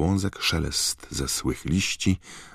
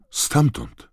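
put-on very high, frightened girl; scared, high-pitched single word; trembling voice; 13 semitones higher, 4 dB louder than usual. A narrator's reading. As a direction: answer in a high, shaken voice. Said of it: "powiedziała Hermiona drżącym głosem".

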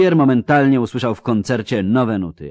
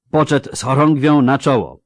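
raised, higher commanding voice; military order; 5 semitones higher, 6 dB louder than usual. A narrator's reading. General drawing his sword before the charge, commanding. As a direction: order the colours to the front. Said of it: "rozkazał, dobywając miecza".

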